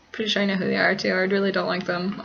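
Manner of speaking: ordinary talk, nothing marked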